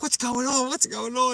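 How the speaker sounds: high, loud mock-panicked question; imitated alarm. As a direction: imitate startled neighbours rushing out. Roused neighbours asking about screams.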